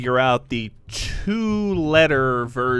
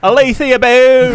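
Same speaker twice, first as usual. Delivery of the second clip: loud mock Australian, higher; Australian accent; 8 semitones higher, 9 dB louder than usual. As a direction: say the name like a broad Australian. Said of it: "easiest to do an Australian accent".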